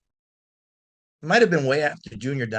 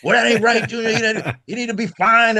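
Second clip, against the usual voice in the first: mock band clamor; 8 semitones higher, 3 dB louder than usual. Band members clamoring for a fine.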